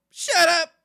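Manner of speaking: loud, high mock "shut up"; playful shout. Real, not acted. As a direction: bark it at chat half-laughing. Playful outburst after name rant.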